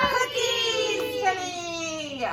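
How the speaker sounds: slow, high, loud reveal; little boy's triumph. Acted. Little boy solving the riddle, high and slow. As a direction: sing it out like a proud child.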